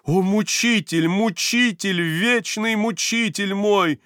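high, slow anguished cry; anguished reproach. A narrator's reading. Varvara cries out at her eternal tormentor, anguished. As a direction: wail at your tormentor.

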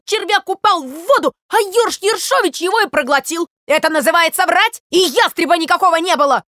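loud, high-pitched tirade; worked-up, shouted outrage; indignant ranting. Acted. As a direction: pour out your grievance as a wronged sparrow. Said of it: "орал Воробей Воробеич".

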